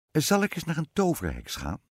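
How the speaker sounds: a touch of storybook queen; lightly voiced queen. A narrator's reading. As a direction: lightly voice a queen floating an idea. Narrator voices queen proposing plan.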